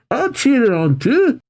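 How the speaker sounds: high, eager chirp; volunteer with giddy enthusiasm; gleeful. Acted. Casey runs off to cheer.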